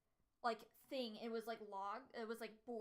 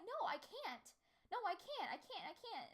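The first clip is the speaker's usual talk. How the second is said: a high, whiny mock refusal; 8 semitones higher, 3 dB louder than usual. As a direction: plead like a scared kid, half-joking. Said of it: "I'm like".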